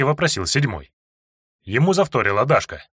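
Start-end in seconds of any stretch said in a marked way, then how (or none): none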